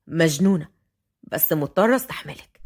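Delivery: low-pitched male inner voice; man musing to himself. A narrator's reading. Fahd to himself about Watin, low musing. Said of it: "وضحك فهد وهو بيقول في نفسه".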